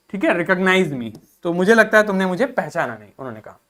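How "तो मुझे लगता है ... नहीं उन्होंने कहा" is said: ordinary delivery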